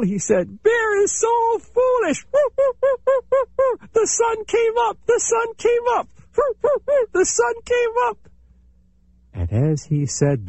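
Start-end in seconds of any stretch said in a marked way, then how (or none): 0.6-8.2 very high, gleeful taunting chipmunk voice; the chipmunk jeering at the bear; mocking chipmunk. A narrator's reading. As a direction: crow over the foolish bear. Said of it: "Chipmunk began to laugh".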